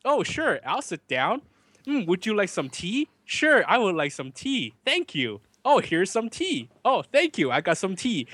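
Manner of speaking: high, slow, exaggerated polite TV chatter; stilted soap dialogue. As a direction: act out wooden drama small talk. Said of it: "So everyone talks like this".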